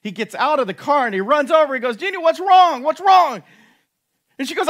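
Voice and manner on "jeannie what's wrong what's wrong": loud, high, panicked calling out to his wife; alarmed shout. Retold or imitated. Husband runs to his crying wife, panicked loud shout.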